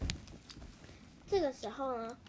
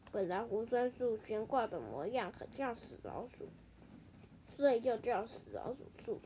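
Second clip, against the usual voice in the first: put-on lightly lowered, softer old grandpa voice; a hint of an old mouse; 3 semitones lower, 6 dB quieter than usual. Grandpa mouse explains the tree's name, lightly voiced old man.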